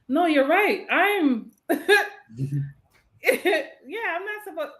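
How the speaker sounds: high-pitched, giggly caving in; mock meekness. Parodying someone being walked all over, high mock-meek voice. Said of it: "operating in meekness".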